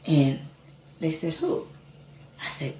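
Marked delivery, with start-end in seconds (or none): 1.3-1.7 high startled question; surprised who. Retold or imitated. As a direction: squeak it out in surprise. Twins asking who he heard.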